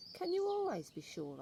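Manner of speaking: put-on high, eager young boy asking; boy's voice. Boy asks his grandmother, high and eager.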